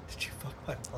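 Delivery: hushed, quick whispered quote; imitated whisper. Quoting Charlie's line to mall Santa, whispered. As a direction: whisper it under your breath, fast. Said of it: "he whispers in his ear".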